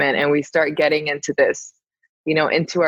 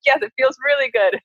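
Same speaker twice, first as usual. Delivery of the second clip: higher-pitched gleeful mock defiance; enjoying a tantrum; 6 semitones higher than usual. Guest telling her partner anger feels good, gleeful tantrum. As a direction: revel in being contrary. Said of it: "enjoying being in my little tantrum".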